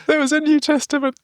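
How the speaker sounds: high squeaky echo of the line, far from his own voice; a shrill put-on voice repeating the reviewer; mock outraged echo. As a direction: squeak it back in disbelief.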